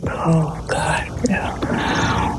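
mock horrified whisper; hushed, whispered imitation of a horrified sister. Shocked sister reacting to a noisy family dinner, horrified whisper. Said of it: "She was horrified".

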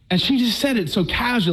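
usual speaking voice

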